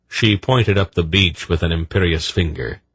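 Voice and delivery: ordinary delivery, nothing marked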